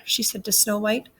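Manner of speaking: ordinary delivery, nothing marked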